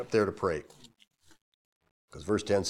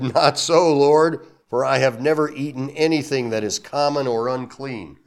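loud, higher indignant refusal; Peter protesting; 3 semitones higher, 8 dB louder than usual. Acted. Peter refusing God's command, loud protest. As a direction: refuse the Lord firmly. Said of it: "But Peter said".

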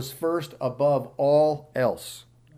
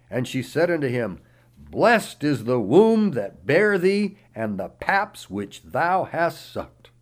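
raised, declaiming Bible reading voice; scripture reading; 4 semitones higher, 7 dB louder than usual. Acted. Pastor reading Matthew aloud to the congregation, declaiming. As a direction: read the verses out like a preacher in full flow.

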